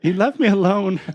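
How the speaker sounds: high-pitched mock whine of blame; mock plaintive excuse. Host rehearses an excuse for the missus, mock plaintive. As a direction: pitch it way up, playfully helpless. Pretend excuse blaming the buddy.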